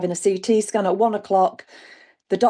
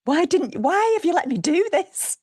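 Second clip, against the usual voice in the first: exasperated plea; 10 semitones higher than usual. Retold or imitated. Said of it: "I said".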